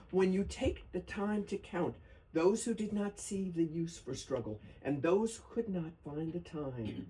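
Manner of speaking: ordinary talk, nothing marked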